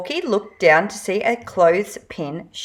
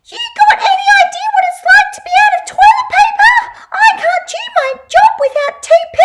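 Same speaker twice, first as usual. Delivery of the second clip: very high, fast, shouted cartoon voice; frantic race car toy; 6 dB louder, much faster than usual. A narrator's reading. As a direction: zip through it like a speeding toy car. Race car desperate about TP.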